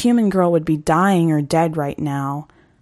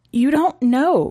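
put-on desperate girl; 8 semitones higher than usual. Girl insisting to the hunter she is dangerous. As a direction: plead that he can't understand. Girl's anguished protest.

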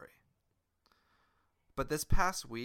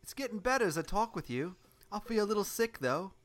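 higher, brighter little engine voice; Thomas the engine voice; 8 semitones higher than usual. Acted. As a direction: play the hurt little engine. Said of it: "you're Thomas".

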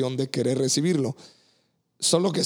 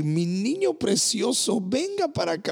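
put-on tender older woman; 9 semitones higher than usual. Older sister at church comforting the lonely pastor, lightly voiced, tender. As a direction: coo it like a motherly sister offering a hug.

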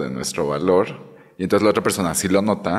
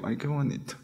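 soft sigh of delight; 6 semitones higher, 13 dB quieter than usual. Retold or imitated. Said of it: "y digo".

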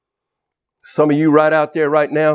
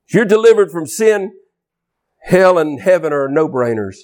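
higher, ringing sermon climax; fervent preaching; 5 semitones higher than usual. Acted. Preacher calling the crowd to be set free, ringing. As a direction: lift it like a revival call.

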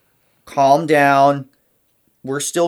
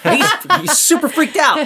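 put-on panicky inner child; 11 semitones higher than usual.

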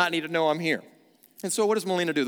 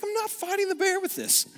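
very high, squeaky mock protest; husband refusing ski poles, squeaky mock panic; scared protest. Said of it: "And I was like".

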